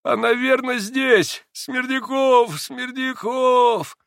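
put-on high, thin, drawn-out old man's cry; slow straining croak up high; feeble wheezing call. A narrator's reading. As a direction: call weakly for a servant, stretching the name. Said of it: "Чуть слышно хрипел старик".

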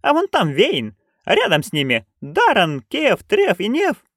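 excited. A narrator's reading. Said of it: "воскликнула счастливая нянюшка".

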